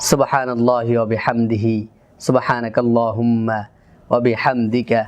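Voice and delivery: low, measured recitation; closing Arabic prayer. Acted. Teacher ending the lesson with a supplication, low measured prayer.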